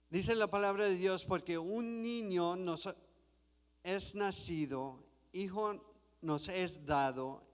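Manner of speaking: usual speaking voice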